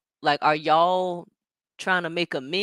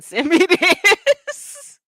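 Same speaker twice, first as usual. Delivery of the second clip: slow, louder, laughing through [name]; 12 semitones higher, 10 dB louder, much slower than usual. Real, not acted. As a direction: drag it out while cracking up. Repeats sender laughing.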